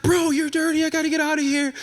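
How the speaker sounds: high-pitched frantic outburst; retold scream. Fleeing date screams at host about the mouse, high-pitched mock scream. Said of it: "And so he's screaming".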